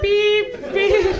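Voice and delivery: high mock hang-up beeps; copying the call cutting off; phone beep imitation. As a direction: beep like a dead line.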